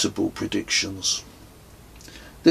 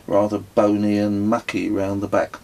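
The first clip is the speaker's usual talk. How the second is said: ordinary delivery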